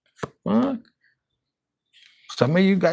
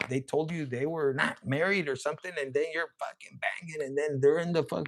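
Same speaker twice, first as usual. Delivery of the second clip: normal talking voice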